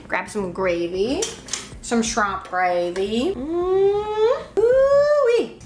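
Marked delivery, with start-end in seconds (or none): 3.3-5.6 delighted ooh-wee. Real, not acted. Tasting the shrimp creole.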